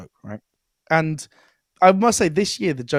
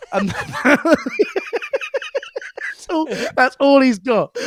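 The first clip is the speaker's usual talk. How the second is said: talking through real laughter, higher voice; laughing; 11 semitones higher, 5 dB louder than usual. Real, not acted. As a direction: crack up while getting the words out. Cracking up at own anecdote.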